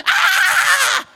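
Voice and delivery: loud, very high "aah" scream; mock scream. Rider careering down the flyover on half a bike, screaming. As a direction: scream as the bike falls apart.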